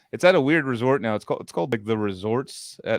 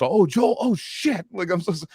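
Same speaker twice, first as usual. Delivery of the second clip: much higher, retold startled oh; mock dawning surprise; sudden recognition; 8 semitones higher than usual. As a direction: play the friend realizing who it is. Leonard suddenly recognizing him.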